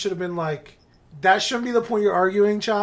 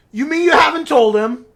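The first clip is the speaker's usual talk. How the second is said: higher, louder incredulous question; mock outrage; 8 semitones higher, 7 dB louder than usual. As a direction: act out an indignant confrontation. Retold giant confronts uncle, outraged.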